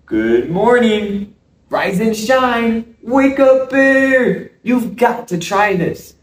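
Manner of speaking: high, slow wake-up call; calling out to wake someone. Acted. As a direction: sing out to rouse a sleeper. Squirrel wakes sleeping Bear.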